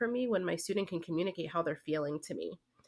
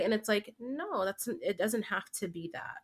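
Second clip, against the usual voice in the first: usual speaking voice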